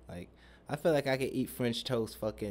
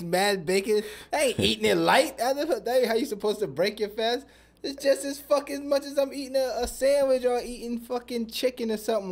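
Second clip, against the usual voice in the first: higher, louder, all fired up about big breakfasts; excited rant; 10 semitones higher, 5 dB louder than usual. Real, not acted. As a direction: rant it like nobody can stop you eating big.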